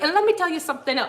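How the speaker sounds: hammed-up German lady impression, rushed out; attempted German accent. Streamer imitating German lady teasing viewers, fake German accent. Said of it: "I can't do the German accent".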